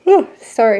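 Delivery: mid-yawn exhale; talking through a yawn. Real, not acted.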